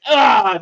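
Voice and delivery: loud, short war cry; battle yell. A narrator's reading. Game master voicing Gloridel jamming the spear, loud war cry. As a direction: roar as the spear goes in. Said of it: "Gloridel who goes".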